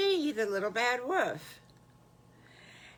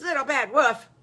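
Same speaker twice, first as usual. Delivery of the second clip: high, loud surprised cry; startled cry; 7 semitones higher, 9 dB louder than usual. Acted. Patty Pig startled by the wolf, loud surprised cry. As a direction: yelp his name in surprise.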